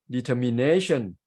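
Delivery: "determination" with NAY lifted and stretched; de-ter-mi-NAY-tion; stress on NA. Shown as a demonstration. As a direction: shift the weight to NAY in "determination". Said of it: "the stress shift to the word nay".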